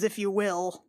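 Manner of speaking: normal talking voice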